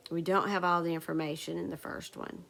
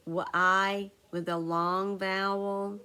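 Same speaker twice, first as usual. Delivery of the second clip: spelling aloud; slower than usual. Shown as a demonstration.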